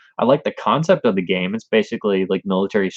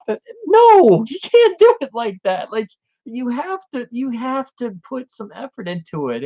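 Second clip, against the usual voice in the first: exasperated. Real, not acted. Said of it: "And I'm like".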